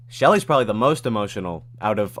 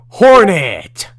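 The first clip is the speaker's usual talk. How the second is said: high, loud startled cry; surprised shout; 8 semitones higher, 13 dB louder than usual. Real, not acted. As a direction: yell it in delight. Gasp then shout.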